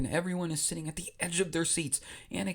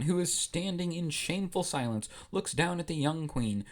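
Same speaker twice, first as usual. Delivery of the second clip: usual speaking voice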